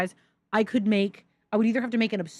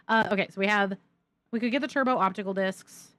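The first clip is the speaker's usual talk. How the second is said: ordinary talk, nothing marked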